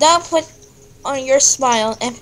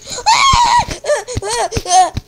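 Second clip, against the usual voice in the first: shrieking laugh; 10 semitones higher than usual. Real, not acted. Reacting to scary face.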